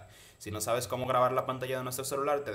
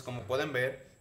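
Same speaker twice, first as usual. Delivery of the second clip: usual speaking voice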